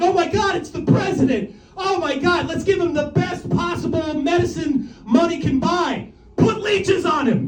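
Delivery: high, hammed-up alarm; mock urgent doctors. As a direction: cry it out like a frantic doctor giving orders. Acted doctors treating shot president.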